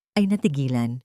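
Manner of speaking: usual speaking voice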